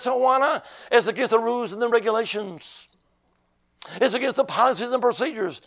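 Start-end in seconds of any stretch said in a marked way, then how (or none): none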